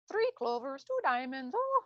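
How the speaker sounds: high, hammed-up Irish lilt imitating a card-reading friend; bad Irish accent. Imitating the Irish friend at a reading, in a bad Irish accent. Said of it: "that's a really bad Irish accent".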